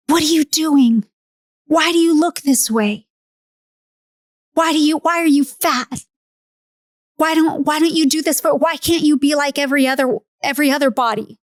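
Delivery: high-pitched, mean berating of one's own body; strained, reproachful interrogation; harsh self-scolding. Retold or imitated. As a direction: scold harshly, piling up accusing questions. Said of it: "spoken to it so harshly, so mean".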